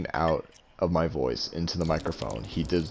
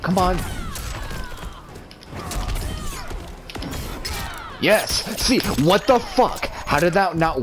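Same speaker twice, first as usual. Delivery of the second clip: raging gamer; 11 semitones higher, slower than usual. Real, not acted. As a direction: rage at the combo failing. Raging at the game.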